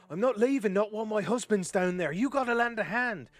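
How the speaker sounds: loud, high, insistent demand; human woman. Acted. Wife refusing to abandon her husband, loud insistent demand. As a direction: belt it out as a desperate wife. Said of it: "a human woman kinda".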